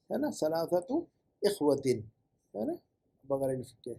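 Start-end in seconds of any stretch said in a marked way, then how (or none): none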